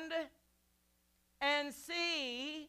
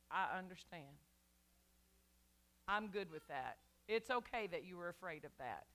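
much softer and lower, mock sympathy; mock soft Jesus; 8 semitones lower, 9 dB quieter than usual. Preacher mocking a soft excuse-making Jesus, hushed. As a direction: croon it as a pretend indulgent Jesus.

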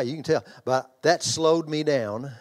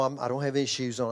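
ordinary talk, nothing marked

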